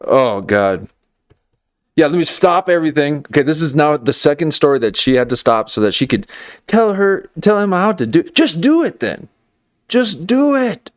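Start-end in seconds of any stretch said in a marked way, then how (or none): 2.0-10.9 high-pitched, exasperated rant; worked up. Real, not acted. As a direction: let the exasperation climb higher and higher. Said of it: "I'm getting worked up right now".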